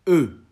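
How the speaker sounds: "E" said loud and high, alone; difficult-letter demo. Shown as a demonstration. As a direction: sound out "E" forcefully as one vowel. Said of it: "les lettres difficiles à prononcer". (« E » prononcé fort et isolé)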